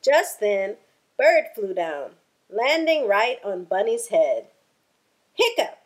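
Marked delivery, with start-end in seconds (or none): 5.3-5.8 sudden high squeaky hiccup. Acted. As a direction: pop out a hiccup. Bird hiccups.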